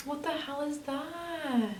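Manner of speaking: gasp, then high, slow, soft shock; startled by what she sees; real shocked gasp. Real, not acted.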